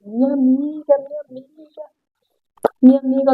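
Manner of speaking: understated mock wail; tearful cry, retold. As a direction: reenact a sobbing greeting, held back. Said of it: "ela começou a gritar, a chorar".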